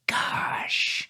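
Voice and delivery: whispered exasperation; dad impression. Son plays his dad giving up at midnight, exasperated whisper. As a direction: breathe it out in a stretched whisper. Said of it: "he looks at me and goes".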